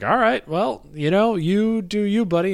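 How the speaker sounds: sarcastic brush-off. Retold or imitated. Brushing off the drug user.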